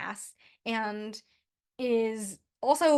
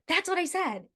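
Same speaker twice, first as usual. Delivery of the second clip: put-on high-pitched, sure-of-himself little boy; lightly acted kid insisting he's right; confident kid; 7 semitones higher than usual. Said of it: "just that confidence of".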